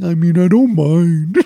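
mock yourself sounding like a dumbass. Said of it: "you're gonna sound like a dumbass".

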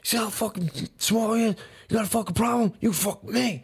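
high-pitched imitation of a fighter squaring up; retold fighting challenge. Retold friend starting a fight.

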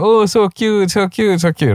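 high gushing coo. Retold or imitated. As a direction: coo over something adorable. Imitating viewers gushing over cat video.

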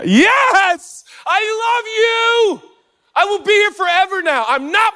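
high, loud joyful cry of delight; thrilled shout. Real, not acted. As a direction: shout it out, overjoyed at the crowd. Crowd knows Fresh Prince theme.

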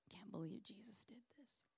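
whispered, under the breath; bitter whisper. Acted. Disciple whispering his disbelief about Jesus. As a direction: hiss it in disbelief, barely voiced.

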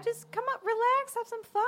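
high, cajoling plea; coaxing high voice. Acted. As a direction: coax her in a high voice. Kai coaxing Mavis to relax.

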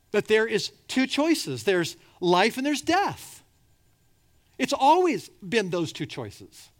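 preaching at full force. Acted. Preacher urging listeners to choose life, fervent and loud. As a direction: drive it home like a revival preacher.

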